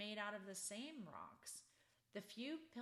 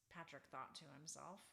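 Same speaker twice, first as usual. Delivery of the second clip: plain reading voice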